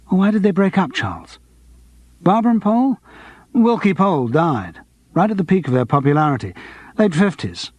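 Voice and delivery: ordinary delivery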